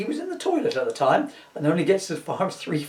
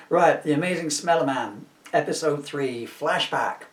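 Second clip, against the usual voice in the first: ordinary delivery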